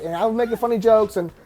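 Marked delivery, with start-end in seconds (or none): none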